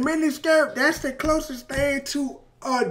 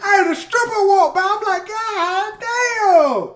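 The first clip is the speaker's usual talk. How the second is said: excited; 6 semitones higher, 4 dB louder, slower than usual. Real, not acted. Reacting, hyped, repeating lyric.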